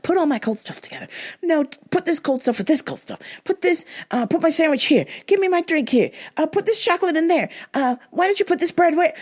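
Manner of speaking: high, fast, fussy orders; bossy customer. Retold or imitated. Imitating the customer ordering the bagger around, high fast. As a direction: rattle off demands, pitched high. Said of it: "First, she was like".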